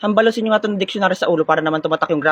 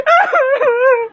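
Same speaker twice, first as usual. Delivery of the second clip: high wild victory laugh; shrill gloating cackle; 4 dB louder than usual. Acted. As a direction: cackle at the loser. (matinis na halakhak)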